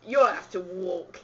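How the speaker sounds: put-on slow, loud Cockney accent. Host telling visitor to walk, Cockney accent. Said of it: "I'll do a Cockney accent".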